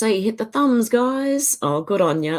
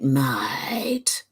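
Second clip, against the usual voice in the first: whispered; 5 semitones lower, 5 dB quieter than usual. Pretend. Whispered end of chant.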